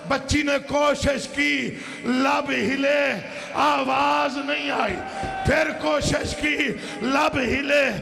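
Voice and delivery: high, drawn-out sermon cry; impassioned lament preaching. Acted. Preacher telling of a child trying to speak before Yazid, high anguished lament. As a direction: stretch each phrase in rising grief.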